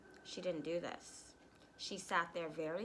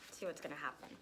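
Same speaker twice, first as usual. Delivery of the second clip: ordinary delivery, nothing marked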